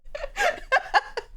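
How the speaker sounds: squealing high laugh. Real, not acted. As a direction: shriek with laughter.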